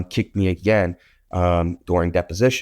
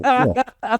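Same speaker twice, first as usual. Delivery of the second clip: high squeak. Retold or imitated. Squeaky exclamation.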